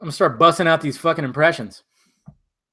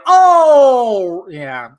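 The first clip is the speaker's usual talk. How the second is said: ordinary delivery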